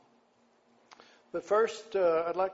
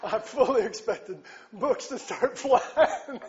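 talking through real laughter, louder; laughing; 7 semitones higher, 7 dB louder than usual. Real, not acted. Preacher to the crowd, laughing at his own joke. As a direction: laugh the line out to the congregation.

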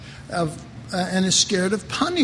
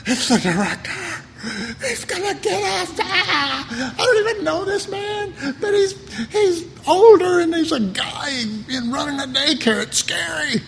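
put-on much higher, tearful childish wail; scared little kids; 9 semitones higher than usual. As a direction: whimper like frightened children. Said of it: "They didn't go".

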